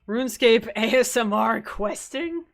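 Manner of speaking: laughing through the words; can't stop giggling while talking. Real, not acted. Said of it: "I just can't stop laughing".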